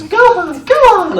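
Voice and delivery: high, loud motherly call; Masako's Gohan call. Retold or imitated. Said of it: "Masako's Gohan line is".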